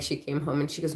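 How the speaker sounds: ordinary delivery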